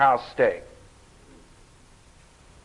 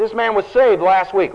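usual speaking voice

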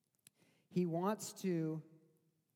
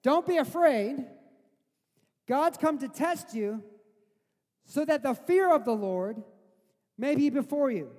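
louder, pressing restatement, higher pitched; emphatic paraphrase; 7 semitones higher, 7 dB louder than usual. Retold or imitated. Preacher restating Moses to his congregation, loud and urging. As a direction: drive the words home to the congregation. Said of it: "think about what he just said".

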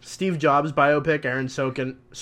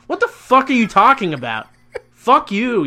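loud, high shouted curse; furious yell; 8 semitones higher, 7 dB louder than usual. Real, not acted. Cursing out the movie to his cohost, loud and high. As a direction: scream at the dumb movie.

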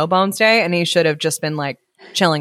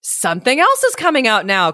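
higher-pitched storybook announcement; excited cry; 6 semitones higher than usual. A narrator's reading. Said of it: "cried the four dogs".

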